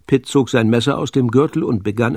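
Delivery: plain reading voice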